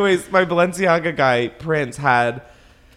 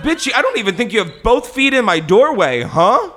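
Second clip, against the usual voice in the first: indignant; 9 semitones higher, 6 dB louder than usual. Retold or imitated. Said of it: "I was like".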